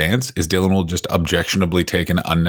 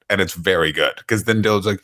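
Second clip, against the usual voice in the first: usual speaking voice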